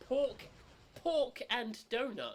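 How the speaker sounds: high, loud and slow jingle voice; hammed-up ad slogan. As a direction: belt it like a cheesy TV ad.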